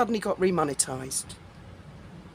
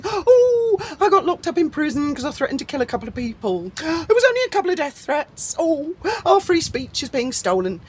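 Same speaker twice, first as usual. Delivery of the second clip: high, mock-wailing whine of complaint; sarcastic; 6 semitones higher than usual. Mimicking people jailed over death threats, sarcastic. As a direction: imitate whiners with heavy sarcasm. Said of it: "Sorry to sound sarcastic".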